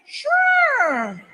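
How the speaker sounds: squeaky high laughing "Sure"; overexcited giggling yes; eager yes. Retold or imitated. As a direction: blurt it out giddy and high.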